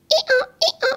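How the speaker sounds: very high giggly squeal; kids laughing. Retold or imitated. Psychologist mimicking children giggling, very high and loud.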